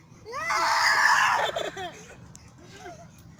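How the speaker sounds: shrieking laugh, high and loud; scream into giggles. Real, not acted. Scream then laughter on swing.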